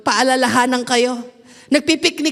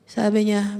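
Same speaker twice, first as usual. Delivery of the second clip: normal talking voice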